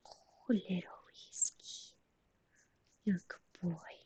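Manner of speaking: slow, hushed cooing at the cat; soft whisper to pet. Real, not acted. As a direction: breathe it softly to a sleepy kitten.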